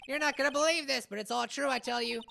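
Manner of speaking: loud, high goofy clown voice; silly clown. Acted. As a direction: ham it up like a circus clown.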